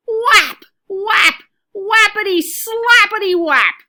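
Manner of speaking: loud, high whaps like slaps; loud comic slapping sounds; whapping sound effect. A narrator's reading. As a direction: punch each whap out like a smack. Sound of paws whapping head.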